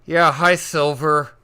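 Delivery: loud, high forced-cheery greeting; through gritted teeth. Retold or imitated. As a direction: fake friendly hello, teeth clenched. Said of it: "through gritted teeth, I go".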